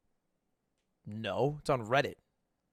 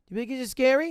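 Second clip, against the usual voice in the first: put-on little girl voice; 9 semitones higher, 3 dB louder than usual. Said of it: "the little girl asks".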